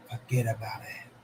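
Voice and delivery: a hushed, breathy whisper of forget about it; whispered catchphrase. Shown as a demonstration. Streamer to chat, whispering the mob catchphrase. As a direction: drop to a secret whisper.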